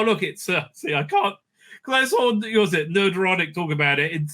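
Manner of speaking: ordinary talk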